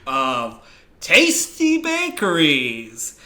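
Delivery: booming, drawn-out TV host intro; [name]. Acted. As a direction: announce the show title grandly and slowly. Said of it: "I will come in and I will say".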